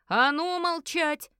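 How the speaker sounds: drawn-out, high roar of command; крик изо всех сил. A narrator's reading. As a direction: shout them all down. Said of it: "закричал, что есть силы". (орёт во всю силу, протяжно)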